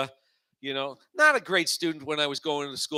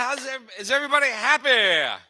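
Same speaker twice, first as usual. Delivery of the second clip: hyped crowd greeting; 8 semitones higher, 5 dB louder than usual. Real, not acted. Host welcoming the open mic crowd, loud and hyped. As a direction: pump up the room as the host.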